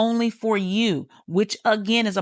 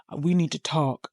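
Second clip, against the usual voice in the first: ominous opener; 4 semitones lower than usual. Retold or imitated. Imitating someone opening a serious conversation, mildly ominous. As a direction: lightly imitate a grave opener.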